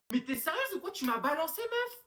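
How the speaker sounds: high, indignant outburst; outraged. Acted. Student outraged her friend snitched on her. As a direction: demand it in disbelief.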